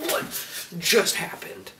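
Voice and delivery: stunned breathy whisper. Retold or imitated. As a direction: whisper it in disbelief. Stunned reaction after hang-up.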